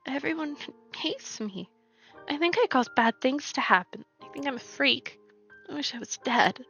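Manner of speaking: tearful. Acted. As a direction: let the tears push the words up. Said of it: "Kaine's tears turned to sobs".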